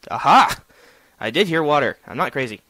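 loud, excited aha; triumphant. Real, not acted. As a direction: cry it out in triumph. Finds water, triumphant.

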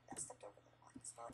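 unvoiced whisper. Real, not acted. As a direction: whisper it under your breath. Whispered repeat after complaint.

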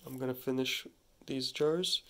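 usual speaking voice

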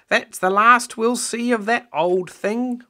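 high, loud, slow grandmother voice; satisfied Grandma. Acted. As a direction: declare it done with, pitched right up. Grandma triumphant at discarding cardigan.

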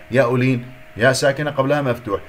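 ordinary talk

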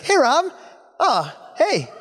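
sudden high, friendly greeting; Osric impression. Imitating Osric greeting Rob in a high voice. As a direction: chirp a hello up high. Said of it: "Osric's like".

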